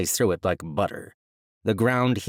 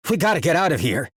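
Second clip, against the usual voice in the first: fast, loud, higher urgent shout; 7 semitones higher, 5 dB louder, faster than usual. Acted. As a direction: shout it in panic. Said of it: "he shouted to Shani and Vex".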